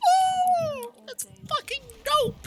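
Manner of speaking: high, loud, thrilled squeal; excited exclaim. Retold or imitated. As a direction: burst out like a fan hyped by the twist. Reacting excitedly to story twist.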